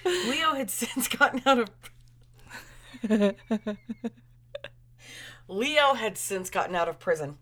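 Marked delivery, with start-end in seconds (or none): 0.0-3.4 higher reading about to crack up; fighting giggles. Real, not acted. Reader retrying a line, fighting giggles. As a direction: try to read while holding in a laugh.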